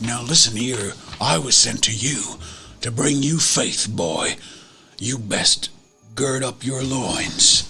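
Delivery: stern preacher. Acted. [name] scolds Noah Stern, a low stern preacher's lecture. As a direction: lean in and lecture him like a pastor. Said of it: "I look at Noah Stern and I'm like".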